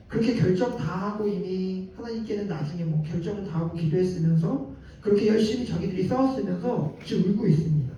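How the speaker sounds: normal talking voice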